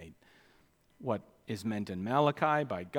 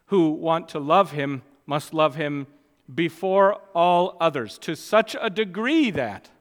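louder, higher, pulpit-force delivery; emphatic preaching; 4 semitones higher, 9 dB louder than usual. Real, not acted. Preacher drives the point home.

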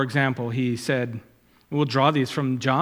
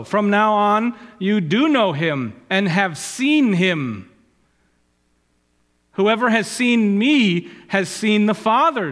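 raised, measured reading of scripture; 5 semitones higher, 4 dB louder than usual. Acted. Preacher quoting Jesus to Martha, solemn reading. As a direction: declaim the words of Jesus.

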